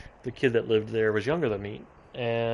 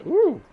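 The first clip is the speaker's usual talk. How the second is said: high, loud "ooh"; sudden whooped ooh of surprise; startled ooh; 9 dB louder than usual. Real, not acted. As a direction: yelp it out, high and sharp. Startled exclamation during play.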